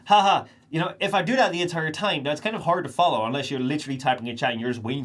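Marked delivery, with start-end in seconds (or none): 0.1-0.5 mock flat laugh. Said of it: "I'm gonna be".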